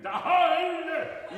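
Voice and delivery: high, loud operatic "Da-"; mock tenor. Coach imitates a tenor belting a note. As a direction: belt it like a showy tenor. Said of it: "a sort of tenor going".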